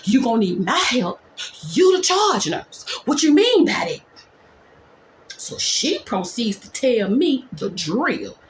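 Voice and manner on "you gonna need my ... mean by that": incredulous retort. Retold or imitated. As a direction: throw it back at her, outraged. Retorting to the charge nurse.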